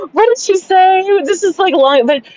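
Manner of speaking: high voice full of laughter; laughing. Real, not acted. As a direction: talk through the giggles while remembering. Laughing while recalling joke.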